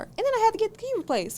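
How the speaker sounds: high, fast complaint; exasperated rush. Real, not acted. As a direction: rush it out, fed up. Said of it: "exasperated list".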